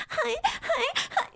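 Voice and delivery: put-on very high squeaky girl voice; high cute anime girl. Shown as a demonstration. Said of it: "doing the anime girl voice".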